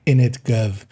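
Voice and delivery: put-on quick cockney English accent; cheeky mock cockney English accent. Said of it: "I feel we have to do that in an English accent".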